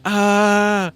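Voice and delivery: long, high-pitched yell; sudden sustained high scream; loud yell. Real, not acted. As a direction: cry out loud and high, holding the note. Sudden yell during game play.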